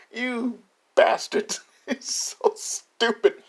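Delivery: high, slow words through laughter; laughing in disbelief. Real, not acted. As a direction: drag the words out while cracking up. Cracking up at the list's reasoning.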